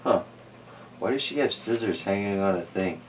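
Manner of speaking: ordinary delivery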